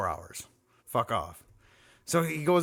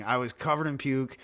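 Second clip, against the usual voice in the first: ordinary talk, nothing marked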